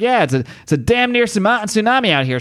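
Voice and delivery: high, fast surprised cop voice, pitched well up; a cop yelling at weirdos in the storm; startled cop. Acted. Cop shouting at strangers in the storm, high and startled.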